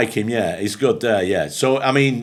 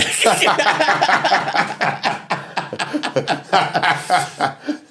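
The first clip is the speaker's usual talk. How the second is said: big laugh; 9 semitones higher than usual. Real, not acted. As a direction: let the laugh take over, rising high. Laughing at his own story.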